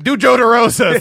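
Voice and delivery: imitating Chip. Chip asking for an impression, high and loud. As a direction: order it like a pushy host. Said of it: "Chip was like".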